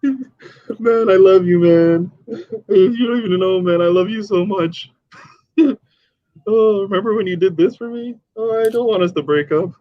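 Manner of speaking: put-on high, slurred drunk sobbing plea; drunk crying. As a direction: play a weepy drunk begging his boss. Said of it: "drunk and crying".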